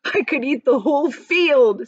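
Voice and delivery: grinning with delight. A narrator's reading. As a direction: savour the words like a happy old man. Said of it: "grinning with delight".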